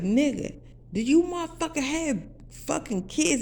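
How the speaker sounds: high-pitched incredulous demand; retold outraged question; indignant confrontation. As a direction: confront them in rising disbelief. Retold angry confrontation of the man.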